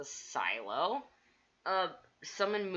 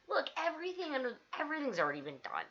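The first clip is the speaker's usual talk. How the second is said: ordinary talk, nothing marked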